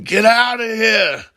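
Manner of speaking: furious high-pitched shout; a blind colonel roaring at the boy to leave; angry yell. Retold or imitated. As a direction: yell at him to get out of the room. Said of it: "Frank is just pissed off".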